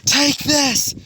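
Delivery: high-pitched attack yell, not full volume; acted battle cry. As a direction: cry it out as you strike. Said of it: "as you would scream out".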